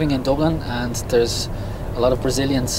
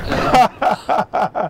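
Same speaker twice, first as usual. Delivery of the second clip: high laughing "you know?"; a real laugh breaking over the words; 11 semitones higher than usual. Real, not acted. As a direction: laugh through the tag question, much higher. Joking about Corinthians, laughs.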